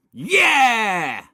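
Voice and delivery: high, loud drawn-out yell; excited cheer. A narrator's reading. Children answering film host, cheering loudly. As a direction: cheer like a roomful of kids.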